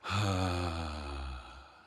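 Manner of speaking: long audible sigh out; voiced sigh. Shown as a demonstration. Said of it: "Sighing the voice out".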